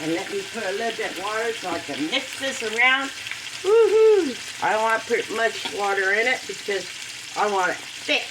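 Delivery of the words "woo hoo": a high woohoo; joyful cheer. Real, not acted. Showing the noodles to viewers, a high happy whoop. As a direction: whoop with delight. Mixing in water.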